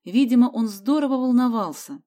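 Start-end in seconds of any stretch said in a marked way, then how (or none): none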